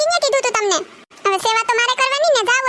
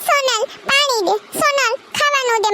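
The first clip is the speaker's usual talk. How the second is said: mocking the husband; 4 semitones higher than usual. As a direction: lightly ham up his nagging orders. Wife mimics bedridden husband's demands.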